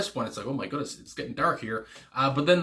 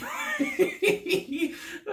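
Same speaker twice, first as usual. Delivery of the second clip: high burst of laughter. Real, not acted. Big laugh at lyric.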